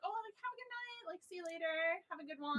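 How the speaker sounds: high, very soft customer-service goodbye; subdued polite sign-off. Retold or imitated. Cashier sees off a rude customer, subdued and polite. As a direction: say it small and high, barely audible.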